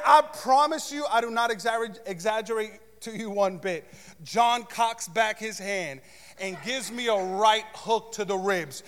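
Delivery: ordinary talk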